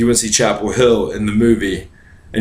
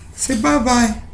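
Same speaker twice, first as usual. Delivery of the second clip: high cooing voice; 12 semitones higher than usual. Acted. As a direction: coo it like talking to a pet. Back to the high voice.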